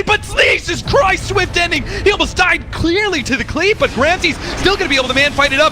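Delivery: high, loud, rushing fight call; excited play-by-play. Acted. Caster yelling the fight to the crowd, high and loud. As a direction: shout the teamfight as it explodes. Casting a teamfight.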